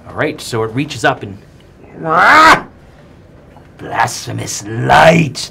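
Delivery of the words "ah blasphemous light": shrieking, loud monstrous chorus; three crone heads hissing in unison; many snarling voices overlapping. Acted. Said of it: "the three heads say in unison".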